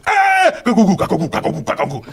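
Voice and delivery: high, loud gibberish outburst; mock caricature yell. Comedian mimicking what the producer heard, wild mock shouting. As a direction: shout a hammed-up nonsense caricature. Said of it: "That's what he would n-, understand".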